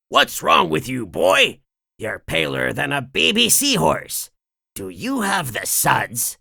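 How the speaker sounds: a hint of a crusty old crab boss, concerned; concerned boss, voiced lightly; lightly voiced gruff boss. Mister Krabs asking sick SpongeBob, lightly voiced boss.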